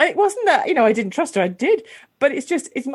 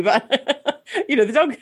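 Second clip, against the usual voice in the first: laughing through the words; chuckling as she explains herself; 3 semitones higher, slower than usual. Real, not acted. To the host about her mum mixing up her and the dog, laughing.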